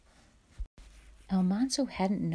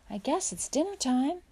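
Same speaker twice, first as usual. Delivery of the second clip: put-on high, louder child's hint; hopeful hint; 10 semitones higher, 5 dB louder than usual. A narrator's reading. Hungry boy to his father about dinner, hopeful hint.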